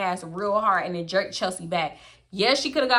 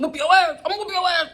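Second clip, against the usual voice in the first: empty mom threat; 8 semitones higher, 4 dB louder than usual. Retold or imitated. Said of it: "I be like".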